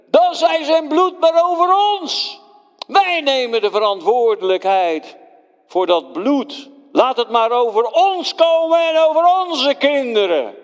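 high, loud mob cry; crowd shouting. Acted. The crowd cries out to Pilate, high and loud. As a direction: roar it back defiantly. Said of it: "Ze riepen".

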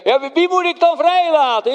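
high, loud appeal to the crowd; Pilate asks loudly. Acted. Pilate calls out to the crowd, high and loud. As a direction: call out the question to the whole square. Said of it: "toen zei ik".

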